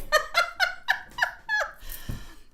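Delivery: a long, high, real laugh that trails on. Real, not acted. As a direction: let the giggles run out. Still laughing.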